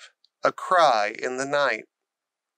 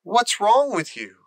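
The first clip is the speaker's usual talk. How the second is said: horrified; 6 semitones higher than usual. A narrator's reading. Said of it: "Mary was horrified".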